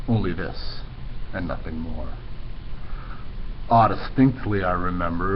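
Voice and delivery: low, hushed, gloomy recital; brooding poem reading. Acted. Teacher reading the Raven to class, low and brooding. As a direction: read it low and heavy like a midnight lament.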